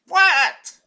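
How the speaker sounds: high-pitched, startled mock disbelief; baffled listeners. Imagined audience going what, mock baffled. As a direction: squeak it out as a stunned audience. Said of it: "you're probably all sitting here".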